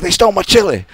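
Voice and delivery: high, loud mock wail of outrage; Wild Bill freaking out. Wild Bill complaining to the bosses, high mock outraged yell. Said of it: "he was just freaking out and cursing".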